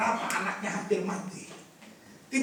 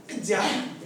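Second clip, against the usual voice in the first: usual speaking voice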